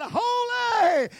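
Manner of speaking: Holy! shouted high and loud; exultant shout. Acted. One seraph outdoing another, shouting Holy!.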